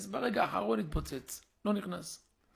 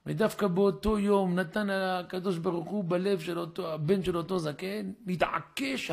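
ordinary talk, nothing marked

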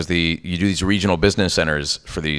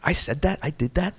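high, soft incredulous questions; acted disbelief; 10 semitones higher, 5 dB quieter than usual. Actor watching own game clip, acted disbelief. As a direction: marvel at yourself in a small high voice.

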